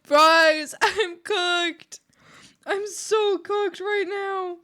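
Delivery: high, loud, hammed-up dread; mock panic. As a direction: whine that you're doomed. Panicked joke about being doomed.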